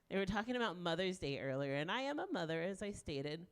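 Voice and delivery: ordinary delivery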